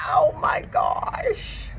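breathless, slow whispered gasp of awe; amazed gasp. Real, not acted. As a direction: gasp it out in wonder. Amazed at plant roots.

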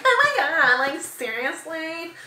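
put-on high, drawn-out Valley girl gush; loud Valley girl squeal, slowing on the last word. Shown as a demonstration. Vlogger mocking how she talks when excited, Valley girl. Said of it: "sound like such a Valley girl".

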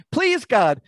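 high-pitched, urgent plea, acted out; pleading. Amos begging God to spare his people, pleading. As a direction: beg on behalf of your people. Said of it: "Amos pleads to God".

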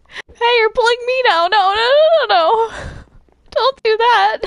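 high, loud panicked yelps; panicked protest. Acted. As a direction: cry out as you're dragged away. Being pulled about, protesting in panic.

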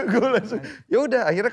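loud, high, laughing through the words; laughing retelling. Real, not acted. Laughs while retelling injury.

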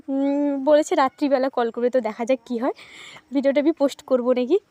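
usual speaking voice

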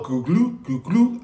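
gulping noise of a bottle tipped up; imitated gulping. Imitating drunk neighbor Alan chugging a fifth, gulping sounds. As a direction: mimic vodka glugging down a throat. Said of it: "you could hear it just go".

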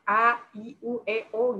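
vowels sounded separately, open-mouthed; artikulasi jelas. Shown as a demonstration. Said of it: "mulutnya dibuka ya". (vokal jelas, mulut terbuka)

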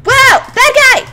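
high, loud alarmed cry; startled shout. Real, not acted. Gamer spotting a monster suddenly, loud alarm.

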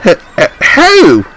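high, loud stammer of disbelief; stutter, then burst out loudly; flabbergasted splutter rising high. A narrator's reading. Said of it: "spluttered Douglas".

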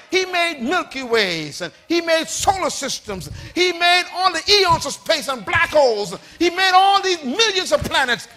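high, rhythmic sermon cadence; driving preacher declamation; impassioned preaching. Real, not acted. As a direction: pile up the list with rousing force. Preaches at full force.

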